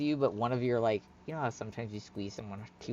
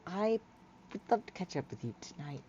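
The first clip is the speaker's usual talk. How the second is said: higher, halting, bashful voice; awkward Hyla; 8 semitones higher, 6 dB quieter than usual. Acted. Hyla asking friend to catch up tonight, bashful. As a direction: fumble shyly between small laughs.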